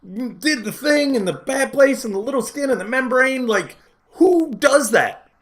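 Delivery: retold yelling. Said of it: "Why are you yelling at me".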